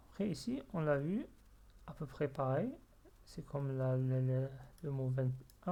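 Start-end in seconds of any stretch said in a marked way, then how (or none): none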